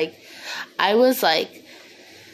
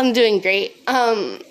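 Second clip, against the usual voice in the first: usual speaking voice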